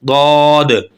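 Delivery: दौद from the sides of the tongue; isolated letter demo; Arabic dhaad. Shown as a demonstration. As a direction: voice the Arabic ض heavily. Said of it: "तो ये निकलेगा दौद".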